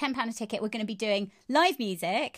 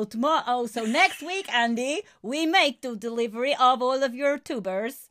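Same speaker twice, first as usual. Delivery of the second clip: Baz voice; 2 semitones higher, 5 dB louder than usual. Pretend. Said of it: "That was Baz".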